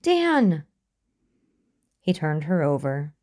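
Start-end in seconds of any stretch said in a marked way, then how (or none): 0.0-0.6 very high, loud cry; shouting. A narrator's reading. Kneeling by his limp friend, desperate shout. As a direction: shout the name higher, stretched in alarm. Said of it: "he shouted".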